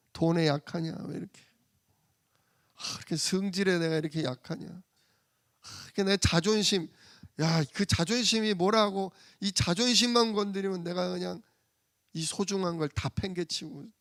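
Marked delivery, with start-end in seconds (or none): none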